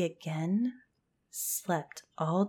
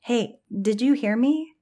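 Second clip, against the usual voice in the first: higher, louder, pressing for attention; insistent; 5 semitones higher, 5 dB louder than usual. A narrator's reading. Hannah to friends who won't respond, insistent. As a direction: raise your voice to get through to them.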